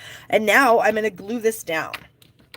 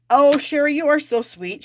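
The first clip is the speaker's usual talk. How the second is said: delighted; 8 semitones higher, 6 dB louder than usual. Real, not acted. Reading a kind viewer comment.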